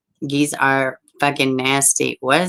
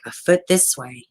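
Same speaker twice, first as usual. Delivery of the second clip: usual speaking voice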